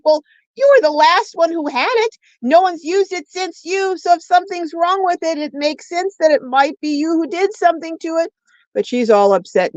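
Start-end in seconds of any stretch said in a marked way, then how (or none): 0.0-8.3 higher, insistent self-justifying; retold defensive retort. Mom retelling her reply to daughter, higher insistent tone. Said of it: "I'm like".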